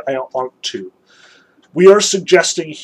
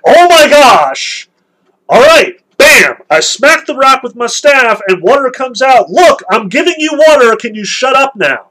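much higher and louder mock yell; hammed-up furious Moses smacking the rock; furious outburst; 9 semitones higher, 8 dB louder than usual. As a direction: explode at the whining crowd. Moses loses his temper.

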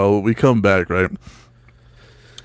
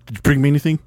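higher put-on voice for the mother; imitated mom; 4 semitones higher, faster than usual. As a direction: ask hopefully as the mom, pitched up. Mom's punchline question, retold.

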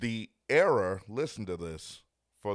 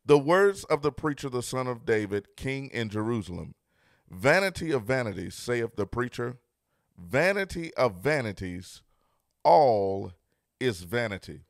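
loud scripture reading; 2 semitones higher, 7 dB louder than usual. Acted. Pastor reading Ecclesiastes to his online congregation, proclaiming loudly. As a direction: proclaim the verses louder, punching all.

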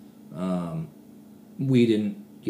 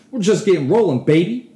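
hyped up; 8 semitones higher, 8 dB louder than usual. Real, not acted. Hyping up podcast listeners.

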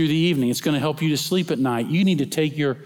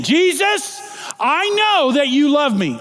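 Jesus called out high, then a loud proclamation; proclaimed louder; 11 semitones higher, 4 dB louder, slower than usual. Shown as a demonstration. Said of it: "I'm gonna say it again".

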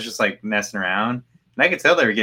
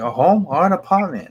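higher-pitched mock exasperation; getting angrier; 7 semitones higher than usual. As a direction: imitate an irritated caller repeating the question. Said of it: "they were getting angrier".

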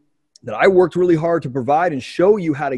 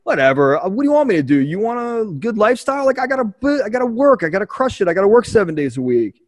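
defensive retort; 7 semitones higher than usual. Retold or imitated. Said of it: "And I'm like".

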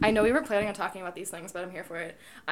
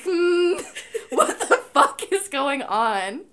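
laughing, baffled; 6 semitones higher, 6 dB louder, much slower than usual. Pretend. Imagining the man's baffled reaction.

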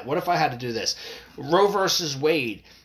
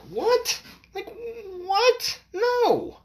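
high, squeaked "what?" of disbelief; incredulous; much slower than usual. Acted. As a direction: balk at it in a shrill, outraged pitch. Outraged disbelief at activists' proposal.

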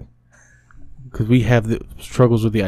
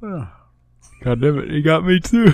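higher voice, talking through a yawn; caught yawning; 5 semitones higher than usual. Real, not acted. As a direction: complain while a real yawn takes over. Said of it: "It's contagious".

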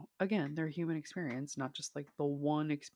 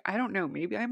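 ordinary talk

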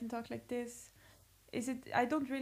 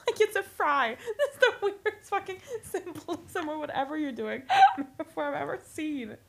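high, loud laughing objection; laughing protest; 5 semitones higher, 6 dB louder than usual. Real, not acted. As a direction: protest through real laughter. Laughing protest at fry metaphor.

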